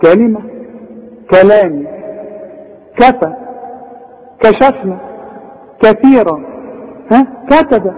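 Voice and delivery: "كلام" with a thin, fronted KEH; correct light Kaf. Shown as a demonstration. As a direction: keep each K light, as in "كثيرا". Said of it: "شوف الصح". ("كلام" بكاف مرققة)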